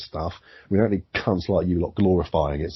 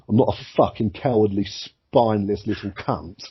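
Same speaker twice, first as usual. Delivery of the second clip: normal talking voice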